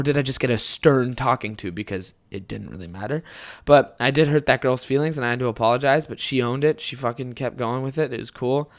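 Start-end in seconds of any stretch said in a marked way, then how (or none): none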